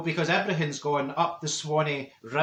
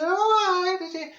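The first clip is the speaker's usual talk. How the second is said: Senga's surprise; 11 semitones higher than usual. Retold or imitated. Senga is surprised Marjorie never knew, a high squeal. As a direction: squeal of surprise at her friend. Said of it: "She says".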